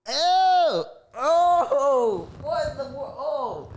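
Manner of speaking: very high, loud disgusted squeal; grossed out. Real, not acted. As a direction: shriek like you touched something slimy. Reacting to tongue text.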